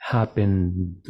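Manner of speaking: "Happened" with the N hum carried into a D; continued vibration. Shown as a demonstration. Teacher applying the vibration rule, voiced ND ending. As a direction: keep the voice going through the end of "Happened". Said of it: "continúo con la vibración".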